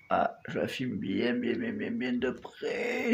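very soft and slow; hushed. Real, not acted. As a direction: murmur close to the insect. Filming close, hushed.

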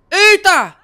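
sudden high loud yelp; startled exclamation. Real, not acted. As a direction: jolt of shock. Shocked by scene.

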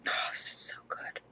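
pure breathy whisper of delight; awed whisper. Real, not acted. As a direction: whisper it like a secret treat. Smelling the candle, whispered.

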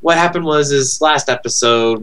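ordinary talk

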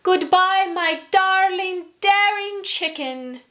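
high, lilting call; sweet sing-song farewell. Acted. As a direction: sing out goodbye fondly. Mitzi calls farewell.

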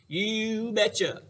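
slow, loud, drawn-out "You betcha"; absent-minded husband. Acted. Husband behind his newspaper answering wife's question, loud careless drawl. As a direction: boom it out like a man who isn't listening.

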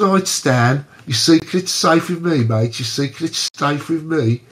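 put-on Birmingham accent. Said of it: "speaks like that from Birmingham".

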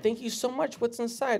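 high, bright-eyed mock gratitude; mock sweet thanks. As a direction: gush like an eager tourist. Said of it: "And I was like".

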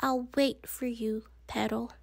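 softly, to a cherished doll; soft and tender. A narrator's reading. Wimberly promising her doll to wait, soft and tender. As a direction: murmur it gently and quiet.

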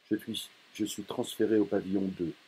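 usual speaking voice

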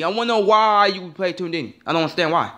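ordinary talk

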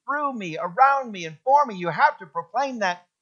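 loud, high preaching; full-force preaching. Acted. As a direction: proclaim it to the whole church.

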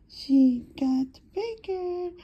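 high, slow cooing voice. Real, not acted. Cooing to the kittens.

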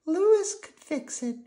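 put-on very high, slow little-girl voice; tiny puppet girl, drawn out; Prairie Dawn. A narrator's reading. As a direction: suggest gently in a falsetto squeak. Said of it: "said Prairie Dawn".

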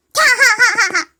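shouted evil cackle; evil villain cackle. Acted. Winner villain laugh.

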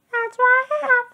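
squeaky Betty Lo reassuring. A narrator's reading. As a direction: comfort him like a sweet small girl. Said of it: "said Betty Lo".

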